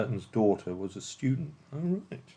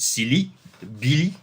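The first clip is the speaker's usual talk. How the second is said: attempted French accent, raised pitch; 3 dB louder than usual. Shown as a demonstration. Quizmaster tries out French village names, attempted French accent. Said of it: "[name] in a French accent".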